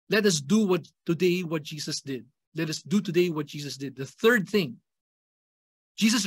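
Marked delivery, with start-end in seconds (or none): none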